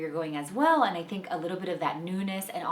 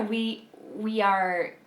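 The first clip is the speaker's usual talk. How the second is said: usual speaking voice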